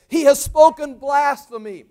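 loud, high, drawn-out declaration; feigned outrage. Acted. High priest tearing his clothes before the court, feigned outrage. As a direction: make a big public show of outrage. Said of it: "he's just playing out the role".

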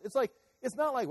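ordinary delivery

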